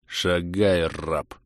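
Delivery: hissed through clenched teeth; low menacing order to a slave; through gritted teeth. A narrator's reading. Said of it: "сквозь зубы процедил он".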